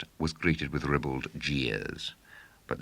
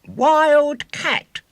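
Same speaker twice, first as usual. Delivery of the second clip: high, loud triumphant announcement; triumphant showman cry; 15 dB louder than usual. A narrator's reading. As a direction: crow it out in triumph. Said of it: "said William triumphantly".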